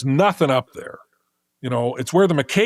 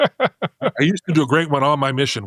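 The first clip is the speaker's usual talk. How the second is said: ordinary talk, nothing marked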